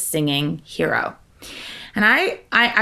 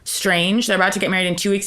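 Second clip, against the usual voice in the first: ordinary talk, nothing marked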